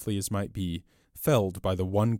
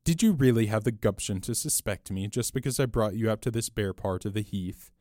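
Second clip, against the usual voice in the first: ordinary delivery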